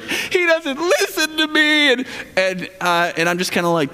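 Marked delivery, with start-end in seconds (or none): 0.0-1.9 high, slowed mock whine of a wife in counseling; a hammed-up aggrieved spouse, high and slow; complaining wife. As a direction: imitate the wife's plaintive complaint, pitched up. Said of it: "you're always like".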